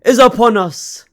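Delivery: high, loud and slow, like a stadium announcer; big hype announcement of the round; dramatic announcer. Acted. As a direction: build it up grandly, drawing each word out.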